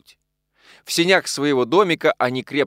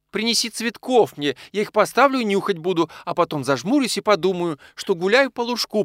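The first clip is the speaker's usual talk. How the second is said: high, dreamy woman's request; daydreaming, high-pitched plea; pleading wife; 8 semitones higher than usual. A narrator's reading. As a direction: ask wistfully in a high voice. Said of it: "попросила Софья Егоровна".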